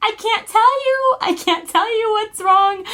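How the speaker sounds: imitated tearful squeal; sounding like crying. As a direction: wail it as if helpless with hysterics. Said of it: "my mom thought I was crying".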